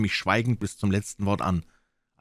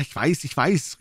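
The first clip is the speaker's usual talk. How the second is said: higher-pitched, impatient assent from the doctor; urgent cry; 10 semitones higher, 4 dB louder than usual. A narrator's reading. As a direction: cut in with raised pitch. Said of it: "rief er".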